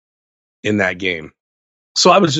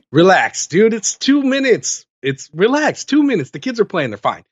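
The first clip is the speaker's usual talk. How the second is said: high, breezy brush-off, pitched up; mock dismissive; 8 semitones higher than usual. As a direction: wave off the worried wives with a laugh. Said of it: "I look up, I'm like".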